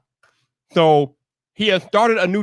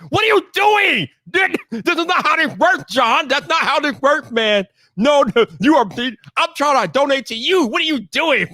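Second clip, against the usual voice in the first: exasperated disbelief; 8 semitones higher than usual. Real, not acted. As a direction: scold a friend playfully. Mock-scolding John about donations.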